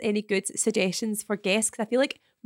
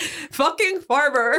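very slow, higher jokey farmer drawl; hammed-up rustic farmer voice; mock farmer voice; 5 semitones higher, 4 dB louder, much slower than usual. Mocking the farmer voice.

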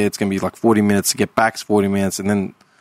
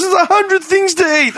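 much higher, exasperated mum voice. Retold or imitated. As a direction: answer like a fed-up mother. Mum's retold reply.

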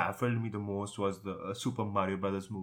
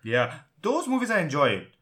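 excited; 8 semitones higher, 6 dB louder than usual. Real, not acted. Enthusing about Resident Evil films.

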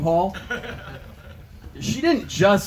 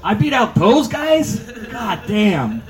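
mock pride; 7 semitones higher, 6 dB louder, slower than usual. Mock proud boast, laughing.

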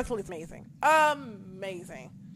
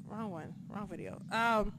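ordinary delivery

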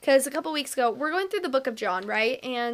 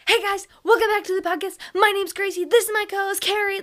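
higher, louder, fast hyped-up host greeting; super aggressive intro; 8 semitones higher, 5 dB louder, faster than usual. Retold or imitated. Old podcast intro to listeners, aggressively hyped, higher, louder, fast. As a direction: blast the intro like an over-eager host. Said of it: "super aggressive".

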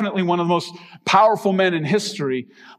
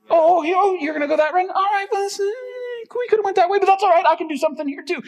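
much higher, surprised but unbothered God; playful God voice. Pretend. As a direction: play God shrugging off a wrong turn, cheerfully. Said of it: "he's like".

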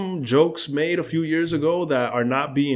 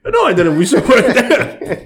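laughing; 10 semitones higher, 7 dB louder than usual. Real, not acted. Laughing denial.

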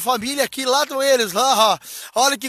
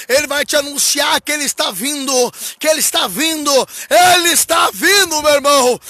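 proclamação em crescendo. Real, not acted. Anúncio repetido em crescendo. (anúncio crescente até o grito)

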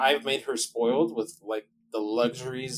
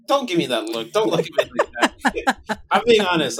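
loud, laughing self-defence; laughing protest; 6 semitones higher, 8 dB louder than usual. Real, not acted. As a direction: protest through real laughter. Protests a listener's look, laughing.

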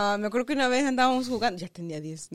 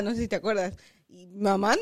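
usual speaking voice

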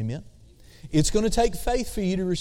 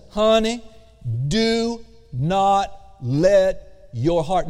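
loving but firm; emphatic tender command; 2 semitones higher, much slower than usual. Shown as a demonstration. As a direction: call her honey, then lay down the command slowly. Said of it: "the Cody translation would say".

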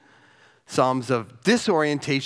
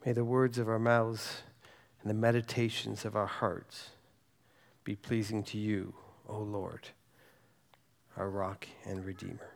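prayerful psalm reading; 3 semitones lower, 4 dB quieter than usual. Acted. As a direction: read it softly as a prayer to God.

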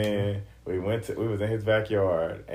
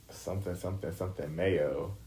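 low, soft, indistinct murmur; imitating a barely audible answer; mumbled reply; 3 semitones lower, 8 dB quieter than usual. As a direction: toss it off quietly, pitch dropped. Muffled answer from friend retold.

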